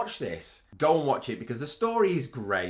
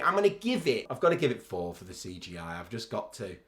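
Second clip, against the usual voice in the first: usual speaking voice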